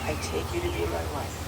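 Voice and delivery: softly fed vow line; quiet prompting. Real, not acted. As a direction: murmur the words for repeating. Officiant feeds vow lines.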